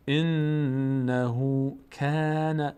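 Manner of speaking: انه کان very slow, the کاف light and thin; light kaf. Shown as a demonstration. Teacher models انه کان with a light kaf.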